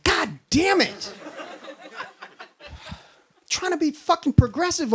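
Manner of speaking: slow, high-pitched cursing; mock exasperation. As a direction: drag out the curse in comic frustration. Acted driver's exasperation.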